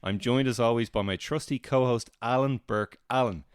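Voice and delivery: bright, upbeat show host voice; podcast host. Acted. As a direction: announce it like a radio host. Fake podcast intro.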